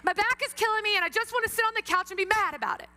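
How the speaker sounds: loud, high-pitched acted rant; angry outburst. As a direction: let all the held-in anger explode, hammed-up. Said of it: "I am so angry right now".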